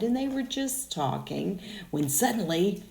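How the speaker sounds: plain reading voice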